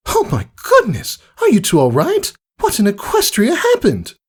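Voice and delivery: high, fretful gasping concern; alarmed refined lady. Acted. Rarity seeing the hurt fillies, alarmed and fussing. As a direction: fuss over the hurt fillies.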